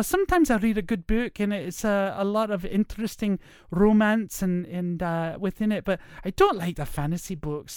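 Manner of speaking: put-on feminine voice with a Scottish accent; lilting Scottish lady, lightly put on; Mrs. Doubtfire. Chatting as a Scottish lady about books, feminine lilt. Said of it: "my feminine voice, it would come out as Missus Doubtfire".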